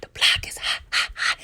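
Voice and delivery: hushed, voiceless repeat trailing off; whispered echo. Pretend. Recapper echoes the street warning for effect, whispered.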